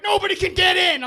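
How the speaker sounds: high, loud imitation of drivers; mock driver shout. Comic imitating drivers blocking a merge, shouted. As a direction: yell as a territorial driver. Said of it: "They're like".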